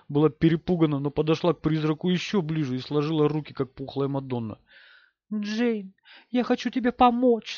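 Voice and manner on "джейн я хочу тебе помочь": high, pleading with a ghost; pleading mother. A narrator's reading. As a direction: beg gently in a raised pitch. Мать умоляет призрак дочери. (умоляюще, высоким голосом)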